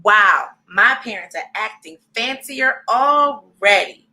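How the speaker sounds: plain reading voice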